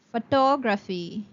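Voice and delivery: photography said pho-TOG-ra-phy; stress on TOG. Shown as a demonstration. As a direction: push the second syllable of photography. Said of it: "the word will be pronounced as".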